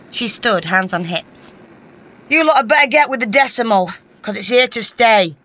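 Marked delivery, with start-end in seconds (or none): 2.3-5.4 loud, quick, raised-pitch telling-off; firm declaration. A narrator's reading. Lucy tells the residents off, declaring it loudly. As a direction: declare it loudly to the whole room. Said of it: "she declared".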